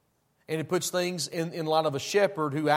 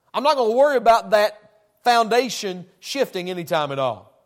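louder, pitched-up pulpit push; preaching; 4 semitones higher, 6 dB louder than usual. Real, not acted. Pastor pressing the rock-foundation point, preaching louder. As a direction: drive the point home to the pews.

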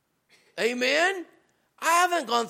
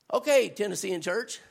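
ordinary talk

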